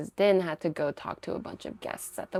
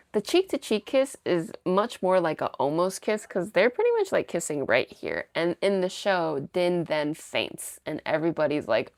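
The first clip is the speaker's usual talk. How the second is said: ordinary delivery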